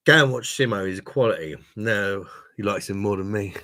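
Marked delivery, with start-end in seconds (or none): 0.1-0.9 loud urging. Real, not acted. Urging subscribers to watch Simo.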